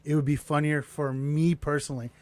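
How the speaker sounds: ordinary delivery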